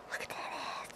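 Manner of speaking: unvoiced secret whisper. Owner whispering teasingly to the cat. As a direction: breathe it as a secret.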